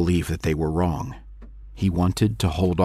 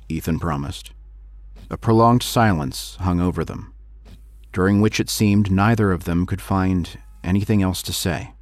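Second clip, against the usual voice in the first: plain reading voice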